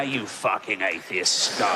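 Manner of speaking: low, slow contemptuous sneer; low sneering zealot. Retold or imitated. Fundamentalist sneering in hell.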